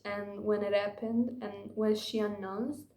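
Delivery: normal talking voice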